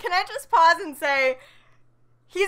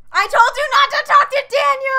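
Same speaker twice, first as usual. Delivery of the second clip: mock jealous yell; 12 semitones higher, 9 dB louder than usual. Friend fakes jealousy over a match named Daniel, hammed-up yell. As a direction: shout it higher and louder, hammed-up jealousy.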